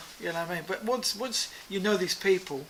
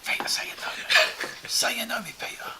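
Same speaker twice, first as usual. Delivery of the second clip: mock pleading whisper; joking whisper; 4 semitones higher than usual. [name] teasing Rick about begging Peter, joking whisper. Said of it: "He was joking along".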